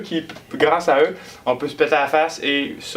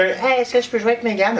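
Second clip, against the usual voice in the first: put-on child voice; 8 semitones higher than usual. Child asking to play.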